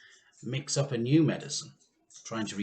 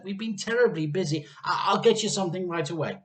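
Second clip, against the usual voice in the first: higher and fast, stumbling apology; flustered rush; 7 semitones higher, 6 dB louder, faster than usual. A narrator's reading. Missus Cranky hurriedly promises Grandma breakfast. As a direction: gabble it out, anxious to please. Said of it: "Missus Cranky said".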